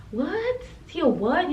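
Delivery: high disbelieving what; incredulous what. Real, not acted. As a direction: squeal the what at yourself. Scolding herself in disbelief.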